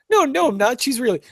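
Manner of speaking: high-pitched protesting imitation; Jimmy. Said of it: "Jimmy's like".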